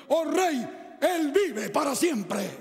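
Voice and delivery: loud, slow, triumphant proclamation; triumphant shout. Acted. Daniel answers the king from the den, shouting in triumph. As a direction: ring it out with victory.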